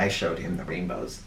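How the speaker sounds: ordinary delivery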